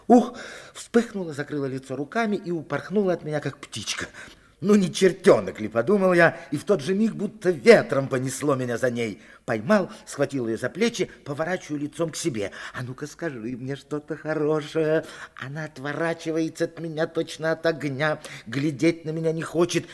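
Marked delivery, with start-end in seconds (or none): 4.6-5.7 loud, high delighted exclamation; excited. Acted. Boy chasing the girl, loud and excited. 5.7-12.5 loud, higher, quickened narration; excited. Acted. Narrator reliving the chase, loud and excited. 12.7-15.1 higher, eager playful demand. Acted. Boy holding the girl, eager demand.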